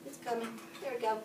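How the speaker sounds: usual speaking voice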